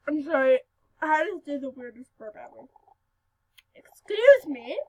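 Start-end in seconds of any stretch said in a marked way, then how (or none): 3.7-4.8 slow, exaggeratedly polite excuse me; proper polite. Shown as a demonstration. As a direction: say it like a well-mannered child. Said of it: "would be the proper thing to say".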